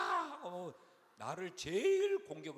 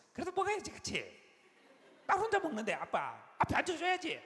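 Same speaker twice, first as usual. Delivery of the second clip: high, loud daughter voice; pushy younger daughter; 13 semitones higher, 8 dB louder than usual. Retold or imitated. Second daughter asking dad about his meal, boisterous. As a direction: imitate a daughter pestering dad at full pitch. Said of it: "막 이러면서".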